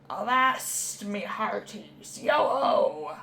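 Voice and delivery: gruff pirate call ending in a shouted yo-ho. Acted. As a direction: play a swaggering pirate hailing his crew. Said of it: "It's my pirate voice".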